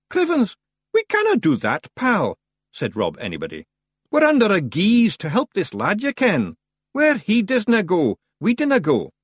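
high, loud Scots pixie; stubborn loyal refusal; Scots Feegle. Acted. Rob Anybody refuses to leave Roland.

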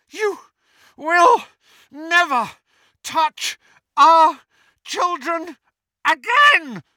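high, loud scream, word by word; furious scream. A narrator's reading. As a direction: scream each word as a separate blow. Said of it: "screamed Missus Weasley".

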